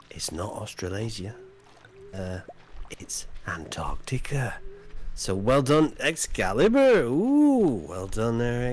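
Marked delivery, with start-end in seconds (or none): 0.1-4.6 whispered reveal. Acted.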